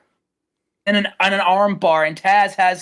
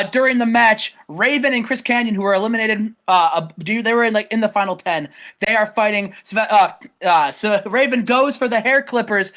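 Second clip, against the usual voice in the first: ordinary talk, nothing marked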